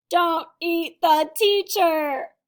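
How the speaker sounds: slow, loud, drawn-out ending; dramatic slow climax. A narrator's reading. Sammy reciting the big lesson, slow and loud. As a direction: land the last line hard and slow.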